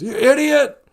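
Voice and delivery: high, loud yell at another driver; road rage yell. Retold or imitated. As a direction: scream it at a stranger who cut you off. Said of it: "screaming at strangers".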